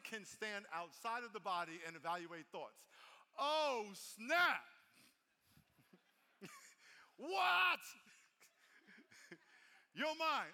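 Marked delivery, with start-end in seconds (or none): 3.4-10.5 shouted, higher, with laughter; mock shock. Pastor hamming up surprise for the church, shouted. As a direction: blurt it out like you're blown away.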